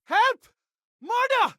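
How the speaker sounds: panicked high shout for help; calling for help. Acted.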